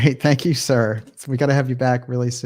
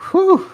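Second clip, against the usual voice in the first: a sharp, much higher and louder whew; high whistle-like whew; 11 dB louder than usual. Real, not acted. Host reacts to a viewer's message with a high, loud whew. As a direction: let out a high delighted whew. Reacting to the Golden Girl comment.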